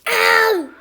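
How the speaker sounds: sharp loud ow; loud yelp of pain. Real, not acted. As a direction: yelp from a bite. Bird bites her.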